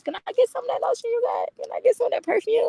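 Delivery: high, fast wheedling ask; begging friend playfully. Retold or imitated. Asking friend for her lotion and perfume, high fast pleading. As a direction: beg sweetly for the lotion. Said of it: "I used to ask her".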